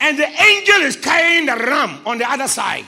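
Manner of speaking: full-force preaching, insistent repetition. Acted. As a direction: drive each line home to the congregation. Preacher acting out Abraham.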